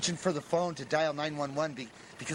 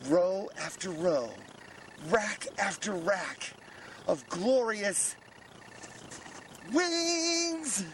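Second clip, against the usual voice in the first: awestruck build; much slower than usual. Acted. Man finding the wings rack, slow awed build to rapture. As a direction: savour it, then soar on wings.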